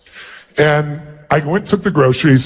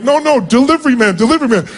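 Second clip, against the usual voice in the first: protesting; 8 semitones higher than usual. Retold or imitated. To the grateful mother, insisting he's only the delivery man, high and urgent. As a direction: wave off the thanks, pitched high and insistent. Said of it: "And I was like".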